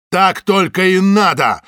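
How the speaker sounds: slow, high, loud angry retort; spluttering. A narrator's reading. Samoylov spits back at Zaytsev, loud angry retort. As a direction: spit it back at him. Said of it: "брызнул слюной Самойлов".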